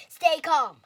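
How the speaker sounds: loud shout to steady them. A narrator's reading. Owl shouting to calm his scared friends. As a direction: take charge loudly. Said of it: "shouted Owl".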